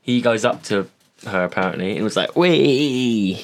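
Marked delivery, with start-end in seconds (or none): 2.3-3.4 higher, louder silly "Wee!" sound; mock playful squeal. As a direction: imitate a goofy playful yelp. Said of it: "Like that".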